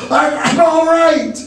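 high, stammered yell; high, insistent call; reassuring yell. A narrator's reading. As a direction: stumble into a high-pitched holler. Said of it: "Briggs yelled to reassure her".

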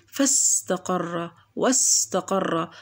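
فاستقر، واستقر drawn out, fa and wa joined straight to s; slow, no glottal stop before s in فاستقر، واستقر; hamza dropped. Shown as a demonstration. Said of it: "وصلت الفاء بالسين مباشرة".

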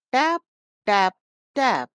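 loud, punchy knock noises; three sharp loud raps; knocking sound. A narrator's reading. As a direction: knock knock on the door. Wolf knocks at the door.

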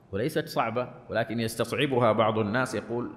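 ordinary talk, nothing marked

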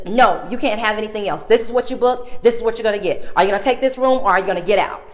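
retold clerk yelling ultimatum; imitated angry clerk. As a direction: throw it at her like a fed-up clerk. Clerk refusing, shouting.

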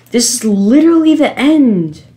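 loud, high frustrated cry; frustrated high-pitched outburst; exasperated. Real, not acted. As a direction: protest it in exasperation, voice rising. Frustrated at failing near the end.

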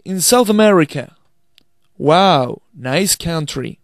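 slow, careful English for learners to repeat; slow dialogue demo. Shown as a demonstration. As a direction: read each line clearly, slowly, as a model to repeat. Teacher rereads dialogue for repetition.

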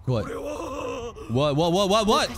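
stunned. Real, not acted. Shocked reaction.

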